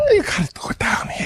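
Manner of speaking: voiceless whispered Walken. Acted. Comic copying the teacher's Walken, whispered. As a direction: echo it back in a whisper. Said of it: "go down into the whisper".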